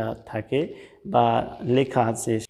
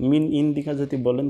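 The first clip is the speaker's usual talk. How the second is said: ordinary talk, nothing marked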